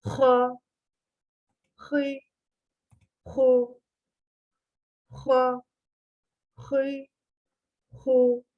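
"Ha, hı, hu" rasped from the top of the throat; growled. Shown as a demonstration. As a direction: scrape "Ha, hı, hu" like a growl. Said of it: "Boğazın en üst katı". (hırlatarak "Ha, hı, hu")

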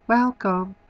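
high-pitched imitation of an electronic door greeting; electronic greeter. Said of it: "greeted by an electronic voice".

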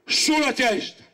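high ringing cry of "Sulla testa!"; rallying cry thrown at the audience; cried out. Acted. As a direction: call it out to the crowd as a rallying cry. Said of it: "e io stasera lo grido a voi".

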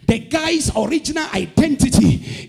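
loud, driving prophetic declaration; full-force preaching. Real, not acted. Preacher declaring a shift to the congregation, full-force preaching. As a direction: hammer out each phrase to the crowd, pitch climbing.

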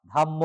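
ধাম্ম with a long dha and a doubled m; correct pronunciation. Shown as a demonstration. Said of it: "দ থেকে ধা". (সঠিক উচ্চারণ ধাম্ম)

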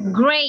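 "Great" with an ay vowel; drill model. Shown as a demonstration. Teacher leads ten repetitions, "Great" like "grayt". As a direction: lead the repetition with a clear "Great". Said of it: "say it ten times".

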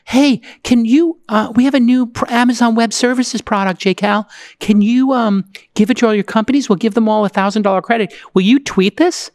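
imitating an eager AWS rep asking for a favour; mock eager rep. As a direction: play the pushy marketer sliding into DMs. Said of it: "And they're like".

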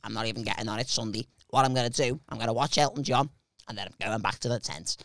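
low, soft, murmured delivery; Chris. Retold or imitated. Friend laying out his Sunday night plan, low soft voice. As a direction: keep it hushed and low as him. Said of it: "He sounds like the type of guy".

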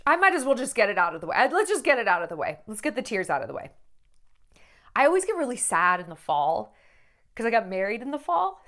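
ordinary delivery

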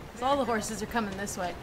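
normal talking voice

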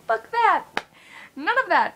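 high, loud dismissive mom; mocking mom impression. Mom dismissing her kid's small joys, mocking. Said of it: "my mom is like".

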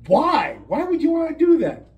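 incredulous and pleading; Ned's baffled protest. Acted.

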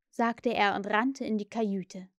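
plain reading voice

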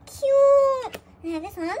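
loud, high, stretched-out cry of delight; excited squeal. Real, not acted. Fan admiring a new album, excited squeal. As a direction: squeal the word with real glee.

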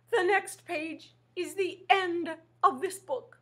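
high, quavering monster wail; terrified monster warning the reader; scared Grover. Acted. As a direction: tremble with fear. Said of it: "says Grover".

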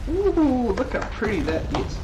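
ooh and ahh at a pretty view; admiring ooh. Real, not acted.

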